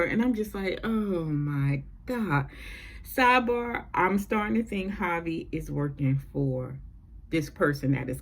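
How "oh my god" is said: slow, drawn-out disbelief; exasperated. Retold or imitated. Viewer fed up with Jordan's outburst, exasperated. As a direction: drag it out, fed up. Her weary reaction to the scene.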